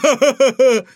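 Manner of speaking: high, loud crazed cackle; maniacal laugh. A narrator's reading. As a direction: cackle like mad. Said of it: "безумно хохотала".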